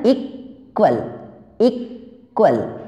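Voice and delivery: Equal with a long break after इक; teacher breaking equal into two pieces; split into syllables. Shown as a demonstration. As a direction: pause mid-word in equal, then drop on the last syllable. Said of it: "जहाँ पे word जो टूटता है".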